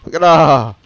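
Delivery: loud, delighted gasp of surprise; loud excited reaction; excited shout. Real, not acted. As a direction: blurt it out at the sight of the feast. Reacting to the food on screen.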